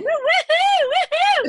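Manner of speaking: high loud woo-hoo; excited cheering. Real, not acted. Cheering friend's outfit.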